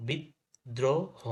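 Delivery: বিদ্রোহ very slowly, bid... dro... ho; doubled দ, syllable by syllable. Shown as a demonstration. Teacher spells it out for students, slow bid-dro-ho for বিদ্রোহ. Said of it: "double হয়ে গেছে".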